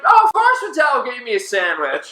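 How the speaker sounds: high-pitched exaggerated taunt; mock chiming in. Storyteller plays someone chiming in about the sandwich, high mocking voice. Another acted friend chimes in, teasing.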